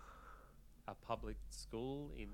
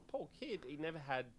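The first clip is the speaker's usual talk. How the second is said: usual speaking voice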